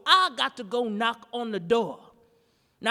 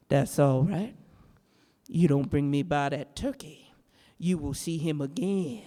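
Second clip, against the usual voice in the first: a hint of a lower, menacing witch; lightly voiced witch; 9 semitones lower than usual. Witch warns the visitor about the turkey, low and menacing.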